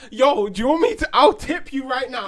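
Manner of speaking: laughing offer. Retold or imitated.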